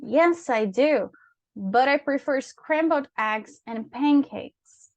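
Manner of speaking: slow, measured English answers for practice; model dialogue reading. Shown as a demonstration. Teacher playing letter B in a student's practice dialogue, slow model reading. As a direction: answer slowly with melodic, teacherly intonation.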